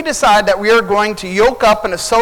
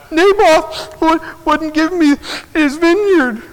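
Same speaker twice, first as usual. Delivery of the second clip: boo-hooing; 9 semitones higher than usual. Acted. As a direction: whine it like a spoiled king in tears. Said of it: "he's boo-hooing, he's crying".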